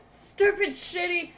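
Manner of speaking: loud, high irritated rant; distant angry grumbling. Acted. Soaked Sky cursing the tree in the rain, loudly annoyed. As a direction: curse loudly as if from far off. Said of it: "you hear in the distance".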